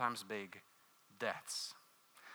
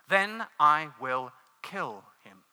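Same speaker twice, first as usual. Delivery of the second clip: slow angel; 7 dB louder, slower than usual. A narrator's reading. Angel's voice in read story.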